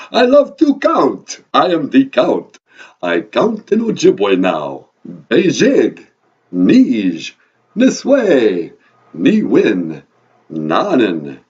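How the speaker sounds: put-on loud, grandiose Transylvanian vampire accent; Count. Count shows off his Ojibwe counting, booming vampire accent. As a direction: proclaim it with relish in a vampire accent. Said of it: "your vampire accent".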